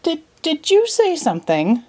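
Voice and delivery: a hint of a befuddled old bird; puzzled dodo. Dodo asking the caterpillar, lightly voiced and puzzled.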